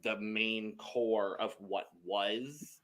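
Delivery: "was" drawn out slowly at the end; deliberate, lengthened emphasis on "was"; stress on "was". Real, not acted. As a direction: lean on the word "was" and stretch it. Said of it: "I'm emphasizing the word was".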